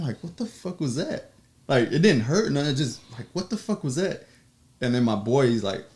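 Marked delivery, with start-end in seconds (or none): 0.2-1.3 retold shock, pitched up and a bit softer; baffled reaction. Reenacts being hit in the head. 3.3-4.3 baffled reaction. Retold or imitated. Repeats reaction to being hit.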